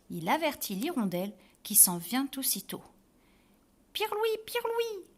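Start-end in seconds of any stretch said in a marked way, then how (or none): 3.9-5.0 high sung swallow call, slow; swallow chirp. Acted. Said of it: "chante l'hirondelle". (gazouillis aigu d'hirondelle)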